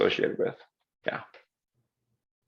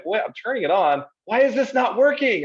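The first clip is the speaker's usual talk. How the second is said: pitched way up, baffled and whiny; frustrated confusion; 11 semitones higher than usual. Retold or imitated. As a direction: act out the puzzled user fighting the toggle. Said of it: "And I'm like".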